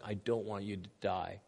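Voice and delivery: a touch of the prophet weeping for his people; mock crying plea. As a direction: beg softly through tears. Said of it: "he's crying".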